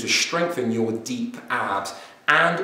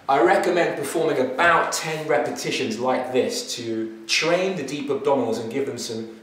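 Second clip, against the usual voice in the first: usual speaking voice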